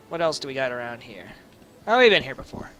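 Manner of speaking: usual speaking voice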